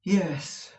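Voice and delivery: dejected yes; miserable. A narrator's reading. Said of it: "I said in a miserable manner".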